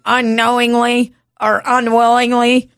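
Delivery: high-pitched ad question; hammed-up, high advert voice; mock commercial. Mock law firm commercial.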